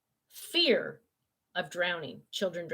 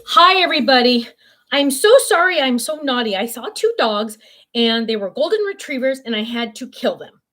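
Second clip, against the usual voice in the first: voicing the dog; 3 semitones higher, 10 dB louder than usual. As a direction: speak for the naughty dog, mock apologetic. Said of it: "now you gotta say hi to everybody and apologize".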